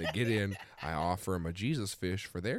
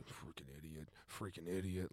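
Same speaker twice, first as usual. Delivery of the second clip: low, very soft grumble; imitated drunken muttering; mumbled cursing; 5 semitones lower, 12 dB quieter than usual. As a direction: mumble it to yourself. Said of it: "he's mumbling stuff".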